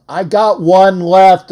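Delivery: normal talking voice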